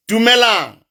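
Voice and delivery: ordinary talk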